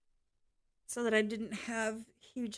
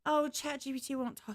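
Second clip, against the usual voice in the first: normal talking voice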